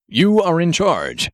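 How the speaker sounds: a hint of a stiff formal hero voice; lightly voiced superhero. Grenade Man instructing Marv from the robot, lightly heroic voice.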